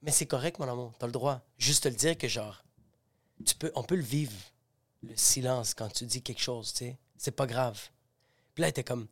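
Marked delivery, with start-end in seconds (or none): 0.0-7.8 low, very soft, tender retelling; gentle reassurance. Retold or imitated. Telling his girlfriend she has the right, gentle and soft. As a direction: reassure your love quietly.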